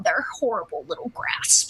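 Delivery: sneering whisper. Pretend. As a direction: hiss it out softly. Villainous aside about the Germans.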